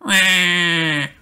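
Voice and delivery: high crying wail; toddler wail. A narrator's reading. Little brother crying over broken toy, high wail. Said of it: "cries George".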